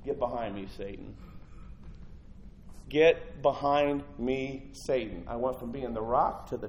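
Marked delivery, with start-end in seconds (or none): 0.0-1.2 low, soft and firm; a calm, heavy warning, almost under the breath; quiet stern rebuke. Acted. Jesus turning to Peter, low and quietly stern. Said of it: "Jesus turned and said". 2.9-5.2 each word drawn out slowly; slow deliberate rebuke. Acted. Jesus repeating his rebuke of Peter, slow and weighty. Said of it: "Jesus turned and said".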